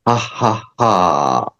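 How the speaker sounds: breathy ha without voice; voiceless ha exhale. Shown as a demonstration. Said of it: "but without the vocal cords".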